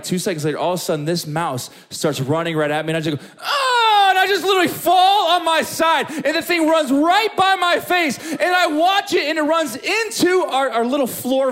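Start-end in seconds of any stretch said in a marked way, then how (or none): 3.4-4.1 long, high, loud yell; comic panicked scream; mock scream. Scream as mouse runs at him. 4.1-11.5 panicked storytelling. Retold or imitated. Tells mouse charge at full panic.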